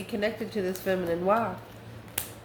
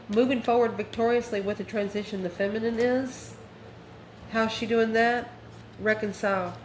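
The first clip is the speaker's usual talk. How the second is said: ordinary talk, nothing marked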